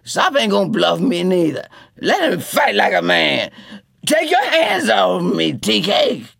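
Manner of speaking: put-on loud, angry drunk; drunken defiance. A narrator's reading. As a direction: challenge him to a fight. Cootie May arguing drunk.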